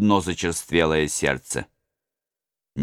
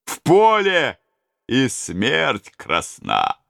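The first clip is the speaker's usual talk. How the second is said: put-on old robber; 7 semitones higher, much slower than usual. Old robber adds a proverb.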